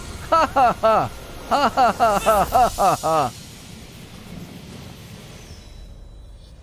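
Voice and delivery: high hammed-up cartoon cackle; cartoon laugh. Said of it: "Trying to do, like, a cartoon laugh".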